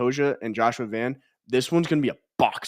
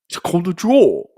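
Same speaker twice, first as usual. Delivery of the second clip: higher, louder imitation of a correction; mock scolding; 6 semitones higher, 6 dB louder than usual. Co-host voicing Brits correcting the American, mock scolding. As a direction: put on an indignant fan correcting someone.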